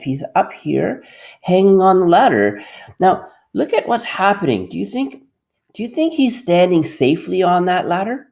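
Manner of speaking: bright, encouraging classroom teacher voice; teacher addressing class. Acted. Teacher talking to an imagined grade three class on the carpet, bright and encouraging. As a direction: coax the kids along like a grade three teacher.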